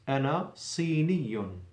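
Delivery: ordinary delivery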